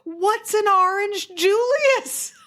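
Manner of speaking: put-on girl baffled by food-court names; baffled wonder. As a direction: puzzle over a strange word. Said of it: "I couldn't even fathom".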